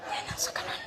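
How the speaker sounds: soft unvoiced whisper. Real, not acted. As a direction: keep it hushed. Hiding during prank setup.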